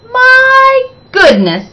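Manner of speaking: amazed. Acted. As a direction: marvel at the hair. Teacher amazed.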